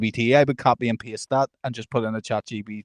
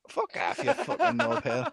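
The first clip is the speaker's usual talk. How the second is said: high hissed swearing at the phone; swearing tirade; 13 semitones higher, 5 dB quieter than usual. Real, not acted. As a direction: spit the insult out in a high whisper.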